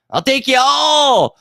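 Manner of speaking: high, loud, slow challenge; drawn-out shouted dare; defiant shout. Real, not acted. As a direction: bellow it at every rival. Challenging rival content creators.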